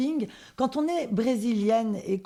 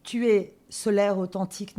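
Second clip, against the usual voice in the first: ordinary talk, nothing marked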